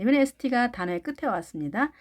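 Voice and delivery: normal talking voice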